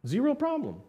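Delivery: high, breezy mock Canadian accent. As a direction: play a cheerful Canadian mall guard, up high. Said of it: "with a little bit of a Canadian accent".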